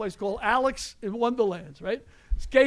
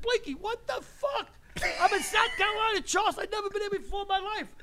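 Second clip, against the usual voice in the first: retold disbelief; 10 semitones higher than usual. Incredulous retold complaint.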